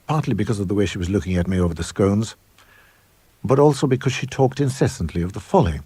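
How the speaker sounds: plain reading voice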